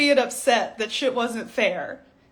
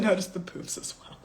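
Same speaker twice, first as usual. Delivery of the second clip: quick whispered quote; a hushed aside from her; 5 semitones lower, 9 dB quieter, faster than usual. Retold or imitated. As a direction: slip it in under your breath. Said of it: "She said".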